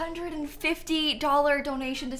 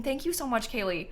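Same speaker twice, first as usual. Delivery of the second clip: usual speaking voice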